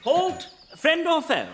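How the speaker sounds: high-pitched military challenge; sentry's challenge. Acted. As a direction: call out sharply to strangers.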